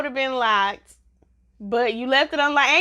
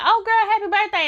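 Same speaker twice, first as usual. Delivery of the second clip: imitating a higher-pitched birthday wish; mock cheery greeting; 8 semitones higher, 4 dB louder than usual. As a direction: chirp it like an oblivious friend. Acts out Danny's cheery birthday greeting.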